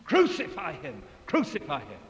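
mild mock mob shout; mob cry. Crowd demanding death, mild shout. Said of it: "crying with one voice".

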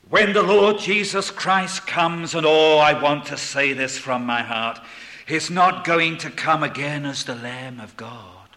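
loud pulpit declaration; earnest, forceful preaching. Real, not acted. Preacher telling how the Lord returns, earnest forceful preaching. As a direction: declare it loudly from the heart.

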